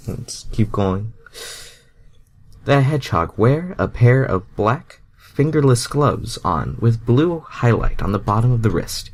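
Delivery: plain reading voice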